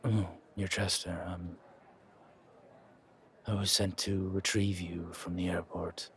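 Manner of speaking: low, soft, distant driver voice; quiet, formal hired driver; reserved chauffeur. Acted. Chauffeur greeting his passenger, low and soft.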